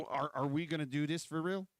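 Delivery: ordinary talk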